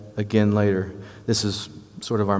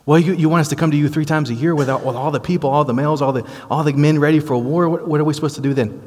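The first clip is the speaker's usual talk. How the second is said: higher, fast, incredulous protest; alarmed Israelites; 6 semitones higher, faster than usual. Retold or imitated. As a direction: push back on God in disbelief, quickly. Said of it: "they're standing over there".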